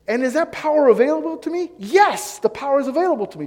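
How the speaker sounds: high, loud, eager realization; light up as it dawns on you; growing excitement. Retold or imitated. Imagined joyful dialogue with God.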